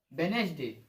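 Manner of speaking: "वेडनेसडे" said wen-es-day, no first D; silent D. Shown as a demonstration. Teacher modelling the correct weekday name, silent D. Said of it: "इसको वेडनेसडे नहीं पढ़ेंगे".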